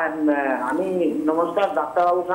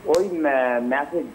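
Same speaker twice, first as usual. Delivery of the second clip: normal talking voice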